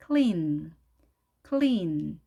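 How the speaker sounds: clean with held L and long EE; precise L. Shown as a demonstration. Teacher demonstrating English clean, clear L and long EE. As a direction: say clean as kl-EEN, tongue tip on the L. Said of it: "L 소리를 정확하게 한 상태에서".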